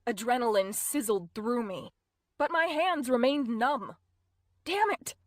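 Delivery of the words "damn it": sharp frustrated hiss; hissed curse. A narrator's reading. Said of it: "I hissed".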